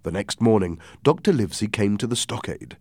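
ordinary delivery, nothing marked

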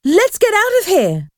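high-pitched panicky urging; terrified. A narrator's reading. Boy wants to flee the ghost, terrified. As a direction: urge escape in a high, frightened voice. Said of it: "He was terrified".

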